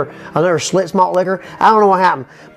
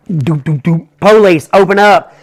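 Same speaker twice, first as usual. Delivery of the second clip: cop knocking and calling much louder; louder police demand at the door, the knock said as words; 4 semitones lower, 5 dB louder, slower than usual. Retold or imitated. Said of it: "They're like".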